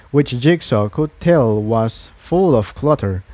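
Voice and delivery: ordinary delivery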